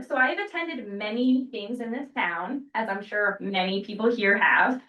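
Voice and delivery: usual speaking voice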